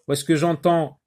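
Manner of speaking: ordinary talk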